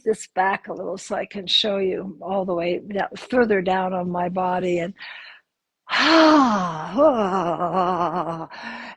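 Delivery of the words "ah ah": long drawn-out releasing sigh; audible sigh. Shown as a demonstration. Teacher showing students sighing to come home, long audible sigh. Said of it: "sighing, humming to come home".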